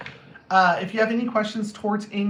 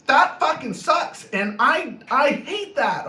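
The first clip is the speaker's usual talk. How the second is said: louder, higher, drawn-out outburst; angry venting; 6 semitones higher, 4 dB louder, slower than usual. Acted. Counselor showing how to be honest about anger, blunt venting. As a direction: vent it with mock exasperation.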